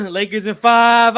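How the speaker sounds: loud, slow laughing tease. Real, not acted. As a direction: laugh while teasing. Laughing, teasing Lane.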